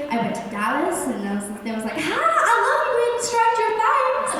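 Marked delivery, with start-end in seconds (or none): 2.0-4.3 high, slow gushing fan voice; mock fan squealing high; excited fan. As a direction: play the starstruck fan in Dallas. Fan in Dallas quoted.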